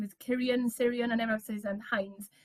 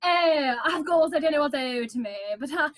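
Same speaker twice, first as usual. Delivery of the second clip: loud, raised, affected bride's voice; Augusta Elliot; 3 semitones higher, 4 dB louder than usual. Acted. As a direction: put on a showy, self-important whine. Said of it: "Remember, she's like".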